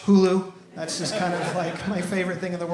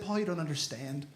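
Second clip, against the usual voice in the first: grave dismay; 3 semitones lower, 8 dB quieter than usual. Retold or imitated. Dismayed teacher explains gravely.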